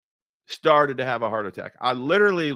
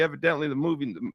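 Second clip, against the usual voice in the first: ordinary talk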